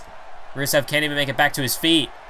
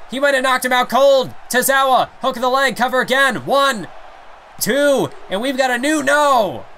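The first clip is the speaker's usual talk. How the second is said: excited shouting; 8 semitones higher than usual. Acted. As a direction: scream the near-fall call. Climactic near-fall, shouting.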